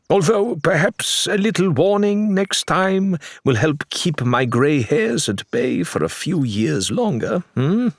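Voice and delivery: put-on a wry older man teasing a friend; Faisal teasing. Faisal gently chides Ned.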